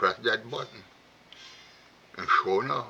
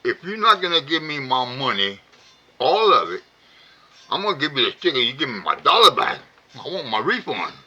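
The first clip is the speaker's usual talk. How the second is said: louder and higher, fed up; angry, rising demand; 5 semitones higher, 7 dB louder than usual. Retold or imitated. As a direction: build the anger up to the refund. Customer escalating the argument.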